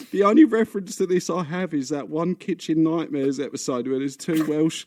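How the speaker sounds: normal talking voice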